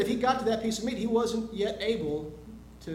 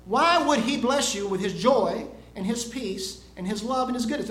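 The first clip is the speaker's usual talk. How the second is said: loud, emphatic sermon delivery; preaching; 7 dB louder than usual. Real, not acted. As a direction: drive the point home to a congregation. Preaches the lesson to congregation.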